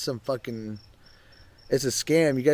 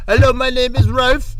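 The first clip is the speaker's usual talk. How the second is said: higher, louder put-on phone rep voice; mock call-center greeting; 11 semitones higher, 8 dB louder than usual. Friend mocking a scam caller's phone greeting, high put-on voice. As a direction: ham up a fake cheery hello.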